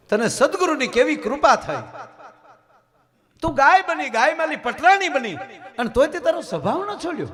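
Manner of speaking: mock-telling her off at full voice; loud scolding. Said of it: "મેં સિન્ટુની ધૂળ કાઢી".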